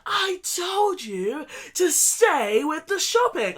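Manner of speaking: slow, mildly imitated yell at partner; hammed-up scolding, dragged out slowly; mock shouting. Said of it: "then you start shouting".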